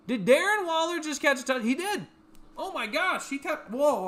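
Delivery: high, loud disbelieving outburst; stunned excitement. Real, not acted. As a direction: react live to an unbelievable catch. Live reaction to an unbelievable catch.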